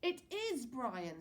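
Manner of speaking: put-on slow, high insistent boy; Billy insisting. A narrator's reading. Little boy to his brother, insistent and slow. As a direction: stretch it out, stubbornly sure.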